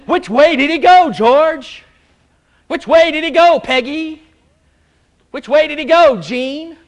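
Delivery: preacher calls on members one by one, loud and high; calling out to congregation. Real, not acted. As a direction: challenge the room with the question, loud and high. Preacher quizzes congregation by name.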